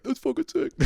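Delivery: high, squeaky imitated excitement; mock delighted approval. Coach first sees the boys' snow drawing, high mock-excited squeal.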